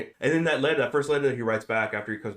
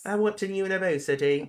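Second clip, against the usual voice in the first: sudden mock British accent, pitched up; a quick, hammed-up British voice; 9 semitones higher than usual. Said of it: "I don't know why I did a British accent".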